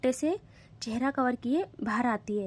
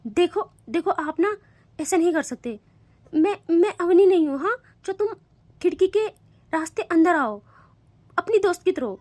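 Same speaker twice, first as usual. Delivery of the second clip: louder, higher protesting whine; anxious protest; 6 semitones higher, 7 dB louder than usual. Acted. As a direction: plead not to be dragged out. Frightened girl protests loudly.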